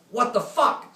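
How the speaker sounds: high, loud panicked scream. Retold or imitated. Helo pilot screaming into the shared radio line. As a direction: scream it out in alarm. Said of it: "screams the helo pilot".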